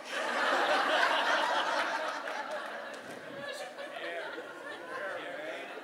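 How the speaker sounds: long unvoiced laugh into the mic; quiet wheezing laugh at his own joke; breathy laugh. Real, not acted. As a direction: let the laugh run out breathily.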